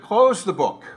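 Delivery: put-on loud, firm German-accented order; stern command. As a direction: bark it like a missionary who won't be refused. Missionary commands the officer.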